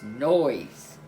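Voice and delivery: ordinary delivery, nothing marked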